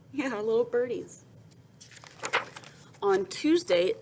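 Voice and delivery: ordinary delivery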